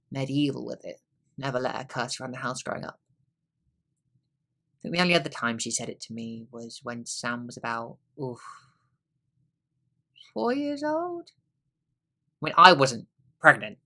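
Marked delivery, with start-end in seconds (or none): none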